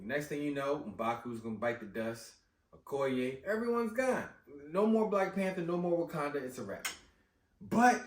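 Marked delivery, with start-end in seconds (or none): none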